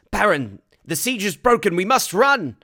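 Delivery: high, loud warning; urgent battle shout. Acted. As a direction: shout to get a comrade fleeing. Urgent order to flee battle.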